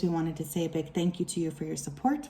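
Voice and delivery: ordinary talk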